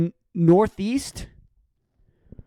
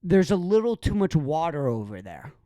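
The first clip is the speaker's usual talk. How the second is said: mocking the excuse maker; 5 semitones lower, 4 dB quieter than usual.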